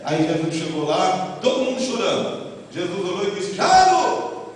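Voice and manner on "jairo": calling the name out loud and high. Retold or imitated. Jesus calling Jairo by name, loud and high. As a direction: call Jairo across the room. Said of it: "Jesus falou abertamente e alto".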